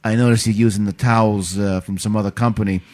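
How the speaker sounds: mock New York Italian mobster voice; Italian tough guy. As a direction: lean on him like a wiseguy. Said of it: "Italian dude comes in".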